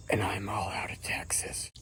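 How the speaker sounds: slow, breathy threat whispered; hushed action-hero whisper; menacing whisper. Acted. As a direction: whisper the line like a cool threat. Hero's menacing one-liner.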